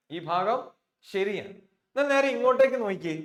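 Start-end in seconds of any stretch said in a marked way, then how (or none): 1.9-3.1 urgent call to attention. Real, not acted. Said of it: "teacher redirects attention".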